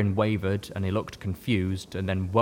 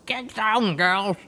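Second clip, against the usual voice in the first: raised, mumbled through a gun trigger in the mouth; said around a mouthful of trigger; 12 semitones higher, 4 dB louder, much slower than usual. A narrator's reading. Heroine warning the trapped fillies, mouth full of trigger. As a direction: call it out high with a trigger between the teeth. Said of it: "I said around a mouthful of trigger".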